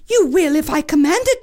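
fast high royal command; commanding princess. Acted. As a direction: declare it imperiously. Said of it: "declared the princess".

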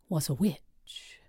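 slow hushed whisper; ominous whisper. A narrator's reading. Ominous reveal.

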